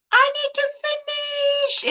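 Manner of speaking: high, wild unhinged villain; crazy voice. Shown as a demonstration. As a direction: shriek it like a madman. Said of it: "in a crazy voice".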